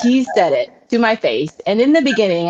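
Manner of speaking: normal talking voice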